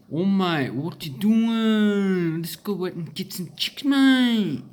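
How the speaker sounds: loud, high, drawn-out Mediterranean-Australian accent; hammed-up Mediterranean-Australian, vowels stretched out loud and high. Shown as a demonstration. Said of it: "Their accent is something like that".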